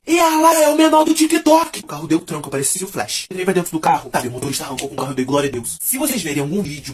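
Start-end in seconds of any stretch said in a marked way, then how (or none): 0.1-1.8 high, slow, loud shout from afar; kid shouting. Retold or imitated. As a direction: yell it like a kid across the street. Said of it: "A criança lá daquela escola gritou assim".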